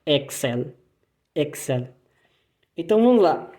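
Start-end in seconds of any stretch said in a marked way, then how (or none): 0.0-0.7 Excel with the L at the end; German Excel. Shown as a demonstration. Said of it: "o L no final, com aquela enrolada". 1.3-1.9 quiet Excel, German L. Shown as a demonstration. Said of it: "o L no final, com aquela enrolada".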